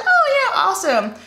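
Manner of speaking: high, loud fake enthusiasm; mock-cheerful. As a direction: play it overly bright on oh yeah. Mock-cheerful reply to client.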